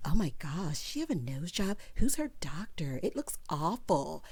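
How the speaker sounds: drawn-out catty taunt dropping toward whispery gossip; mock mean girls. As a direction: imitate catty cafeteria gossip, stretched out then hushed. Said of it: "cackling like mean girls in the cafeteria".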